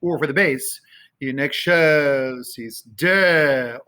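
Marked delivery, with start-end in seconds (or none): 1.2-3.8 slow "excelsis Deo", stretched ek-SHELL-sis DEH-o; emphasis on shell and de. Shown as a demonstration. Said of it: "I'm putting emphasis on shell and de".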